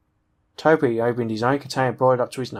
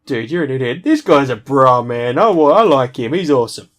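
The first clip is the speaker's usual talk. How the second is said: loud, tickled outburst with big stressed words; boisterous amusement; 7 dB louder than usual. Acted. Derek cracking up over his friend's remark, loud and amused. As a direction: punch it out loud and delighted.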